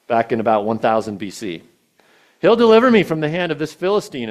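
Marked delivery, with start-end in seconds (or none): 2.4-4.3 fast, high and loud, full of confidence; bold declaration. Acted. David telling Saul God will save him, fast and loud. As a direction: proclaim it quick and fearless.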